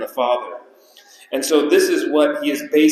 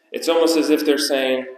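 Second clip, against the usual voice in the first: ordinary talk, nothing marked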